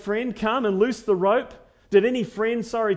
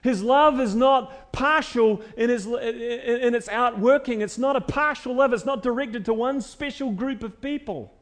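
fervent raised preaching voice; 6 semitones higher, 3 dB louder than usual. Acted. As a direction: preach it out to the congregation. Preaching at full force.

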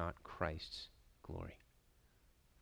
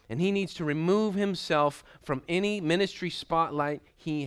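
higher and louder sustained preaching; impassioned preaching; 8 semitones higher, 9 dB louder than usual. Acted. Preacher proclaiming Christ's prominence to the congregation, loud and high. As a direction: preach it out with fire.

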